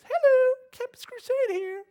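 very high, loud mock door-to-door call. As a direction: holler it out like a cheery canvasser. Imitating door-knocker calling out to residents.

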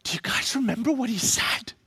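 breathless whispered question; hushed gasp of realization; awed whisper. Acted. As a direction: whisper it as if discovering something. Woman gasps at empty tomb.

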